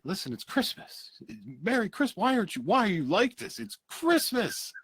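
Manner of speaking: high, loud, giddy Christmas joy; gushing cheer. Acted. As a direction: squeal it like a kid on Christmas. George gushing about Christmas.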